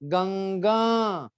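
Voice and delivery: गंगा very slow and clear; slow clear demo. Shown as a demonstration. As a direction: spell out गंगा with the voice. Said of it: "clear करने के लिए बोला गंगा". (गंगा साफ़-साफ़ धीरे बोलना)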